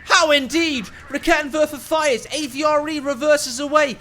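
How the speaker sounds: breathless live commentary shout; excited shouting play-by-play. Real, not acted. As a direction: yell the fight as it unfolds. Caster calling a tense fight.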